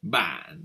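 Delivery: ban low with a short drop; falling tone. Shown as a demonstration. Teacher shows how to say ban for you. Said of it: "on dit".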